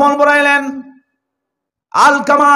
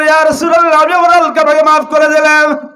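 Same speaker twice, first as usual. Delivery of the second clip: high, louder acted outcry; retold cry out; 4 semitones higher, 4 dB louder than usual. Mother calls to the Prophet that she forgives, acted crying out. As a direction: cry it out high in one rush, as a mother relenting. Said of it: "চিৎকার দিয়ে জমিনে পড়ে গেলেন".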